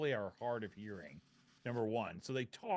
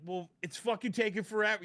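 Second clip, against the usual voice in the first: louder, higher, impatient demand; loud pushy customer; 7 semitones higher, 6 dB louder than usual. Retold or imitated. A pushy New Yorker yelling for his order, loud and impatient. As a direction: bark it at the counter.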